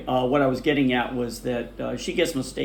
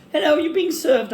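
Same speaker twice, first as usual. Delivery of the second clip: high, louder mock British sales clerk; British shop lady; 5 dB louder than usual. Heathrow lady greeting travellers, mock British clerk voice. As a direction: sing it out like a posh British shop assistant. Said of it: "and the lady says".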